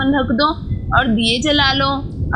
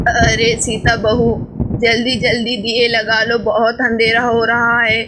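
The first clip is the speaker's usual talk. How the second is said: put-on old grandmother; 3 semitones lower than usual. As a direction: speak low and unhurried like an elderly grandma. Grandmother voiced by narrator.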